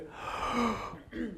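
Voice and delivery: sharp unvoiced demo gasp in. Shown as a demonstration. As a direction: show a quick panicked intake of breath. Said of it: "what are you going to do?".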